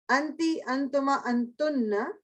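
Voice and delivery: slow, drawn-out अंति, अंतुमा, अंतुन्ना with a rising end; drilled feminine pronouns. Shown as a demonstration. Teacher drilling feminine pronouns with the class, slow and stretched. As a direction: recite अंति, अंतुमा, अंतुन्ना slowly, stretching the last word. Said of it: "अब feminine".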